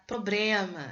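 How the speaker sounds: "Problema" with R for L, /pɾoblemɐ/; rhotacism demo. Shown as a demonstration. Teacher demoing "Problema" said with R instead of L. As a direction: say "Problema" swapping the L for an R. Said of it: "Esse problema aí é um caso de rotacismo".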